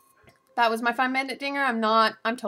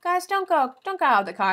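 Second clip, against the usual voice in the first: warning call; 8 semitones higher, faster than usual. Retold or imitated. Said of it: "she's just like".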